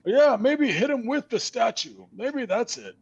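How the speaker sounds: high, loud eager skeleton. Acted. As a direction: cheer them on like a daft skeleton. Skeleton eggs them on.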